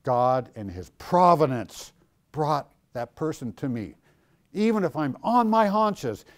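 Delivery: ordinary delivery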